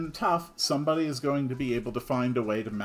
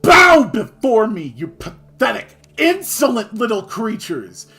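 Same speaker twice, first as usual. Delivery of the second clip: booming megalomaniac god voice; dark lord; 9 semitones higher, 14 dB louder than usual. Pretend. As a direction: roar it like a mad tyrant god. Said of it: "megalomania ran away with me".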